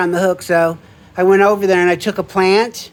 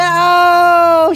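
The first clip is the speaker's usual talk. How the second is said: surprised oh; 7 semitones higher, 5 dB louder than usual. Retold or imitated. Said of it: "she said".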